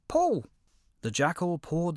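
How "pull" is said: high, loud little yell; squeaky shout. Acted. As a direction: shout it up from the pit in a tiny voice. Hedgehog shouts from pit.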